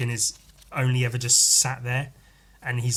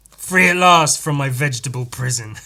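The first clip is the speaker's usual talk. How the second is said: booming freed-monster voice, louder; 3 semitones higher, 9 dB louder than usual. Acted. Monster bursting from a turnip, loud and gloating. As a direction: announce your release like a gloating monster.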